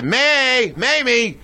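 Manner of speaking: dad teasing his wife about the big shot; imitating father. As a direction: play the father egging the kid on. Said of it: "my father would say".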